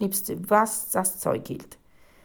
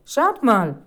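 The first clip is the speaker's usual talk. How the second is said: loud, raised exclamation; surprised call; 4 semitones higher, 8 dB louder than usual. A narrator's reading. Boy discovering a letter, calling out surprised. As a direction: draw everyone's eyes to a find. Boy calls others to his find.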